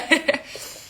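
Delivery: short, high laugh; real little giggle; self-amused chuckle. Real, not acted. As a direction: chuckle at yourself. Amused at own changed accent.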